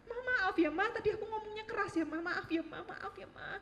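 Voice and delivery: high, pleading sorry to mother; pleading apology. Retold or imitated. Hugging her mother's legs, a high pleading apology.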